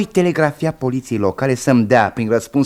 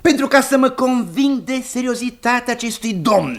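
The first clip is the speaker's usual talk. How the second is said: very high, insistent retort; emphatic self-justification; 12 semitones higher than usual. Acted. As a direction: protest at high pitch, justifying yourself. Husband defends his investigation indignantly. (justificare apăsată, pe ton foarte înalt)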